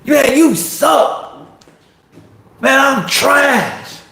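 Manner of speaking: high, drawn-out self-trashing cry; mocking voice of self-doubt, slow and high; loud inner critic. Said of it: "that voice in your head is so loud".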